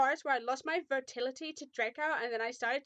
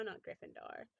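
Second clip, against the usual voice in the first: usual speaking voice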